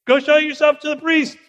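high, loud shout across a distance; loud, high-pitched command; calling back. Acted. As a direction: shout the answer to people far off. Jesus answers lepers far away.

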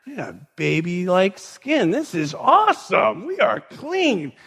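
slow, rising wonder and joy; amazed elation. Acted. Healed lepers marvel at their skin.

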